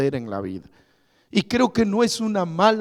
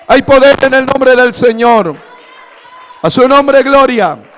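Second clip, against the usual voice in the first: higher, louder shout on "poder"; shouted proclamation; 8 semitones higher, 11 dB louder than usual. Acted. Pastor crying out to the congregation in a loud, high shout. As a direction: cry it out to the whole church. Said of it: "repeated proclamation, shouted".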